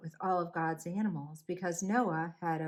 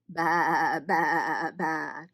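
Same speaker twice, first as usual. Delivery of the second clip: wobbly storybook baas; sheep bleating; 5 dB louder, much slower than usual. A narrator's reading.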